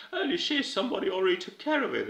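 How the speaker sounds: high, quick exclamation of realization; mock surprise. Acting out Clark's sudden realization.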